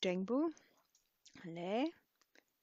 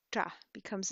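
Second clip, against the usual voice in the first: normal talking voice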